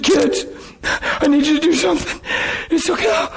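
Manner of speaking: put-on high, strained plea to his kids; high-pitched retold plea; high pleading. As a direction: beg them gently in a high voice.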